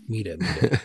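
Mita mita said softly; Mita demo. Shown as a demonstration. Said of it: "Like pita pita, but with an M".

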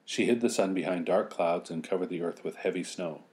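usual speaking voice

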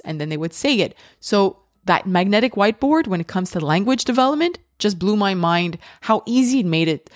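ordinary delivery

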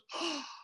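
sharp mock gasp of realization; startled gasp. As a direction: gasp as if it just hit you. Imitates listeners' startled reaction.

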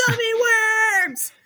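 high, drawn-out comeback; comic high-voiced defiance; mock defiant cry. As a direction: wail it stubbornly, playing up the silly quarrel. Acted defiant reply in quarrel.

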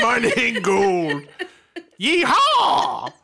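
slow, high hillbilly prospector; old prospector voice. Pretend. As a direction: drawl it like a gold miner. Prospector voice, yeehaw.

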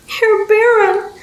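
put-on slow, very high childlike appeal; high-pitched, drawn-out plea; frantic pleading. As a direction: beg like a desperate little girl. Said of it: "Adelina pleaded frantically".

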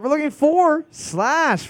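slow, high host reveal; drawn-out announcement. Acted. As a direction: stretch out the reveal.

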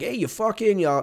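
put-on high-pitched Northern accent parody; Mark Wahlberg. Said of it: "all sound like Mark Wahlberg".

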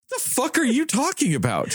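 high, disbelieving retort; incredulous. Retold or imitated. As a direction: throw it back in total disbelief.

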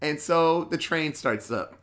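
ordinary talk, nothing marked

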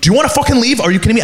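high-pitched, confrontational retold challenge; aggressive bouncer. Bouncer challenging a customer to leave, aggressive. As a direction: imitate a bouncer losing patience.

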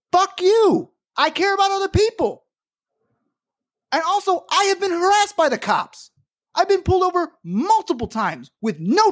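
high, hot outburst of real anger. Real, not acted. Angry host snapping back at customers who ask why he cares, furious. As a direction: let the fury break out, pitched high. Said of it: "I'm sorry I'm so mad".

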